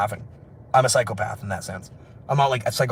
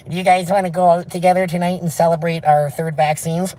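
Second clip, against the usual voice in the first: higher caricature voice; mock sheep diner; 4 semitones higher, 4 dB louder than usual. Inviting friends to celebrate vaccines, high mock-sheep voice.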